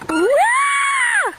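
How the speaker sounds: high shriek into a laugh; screaming while falling. Real, not acted. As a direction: scream then laugh at the drop. Flung about on swing.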